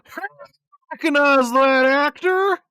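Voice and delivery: high, loud, slow obnoxious question; loud movie talker. Retold or imitated. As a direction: blurt it out mid-scene. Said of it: "he'll just be like".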